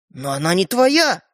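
insistent, drawn-out objection; protesting. Acted. Brother arguing over whose toy it is, protesting. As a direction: press the point slowly and firmly.

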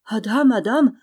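taken aback, indignant exclamation; choked with astonishment. A narrator's reading. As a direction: splutter in stunned protest. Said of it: "dit Javotte suffoquée".

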